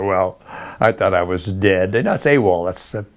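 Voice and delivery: ordinary talk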